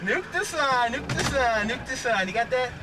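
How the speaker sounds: normal talking voice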